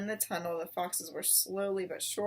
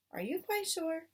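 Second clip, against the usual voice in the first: higher anxious voice; worried mother; 9 semitones higher than usual. Acted. Missus Fox asking husband anxiously, higher voice. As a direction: ask with worried doubt.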